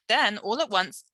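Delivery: ordinary delivery, nothing marked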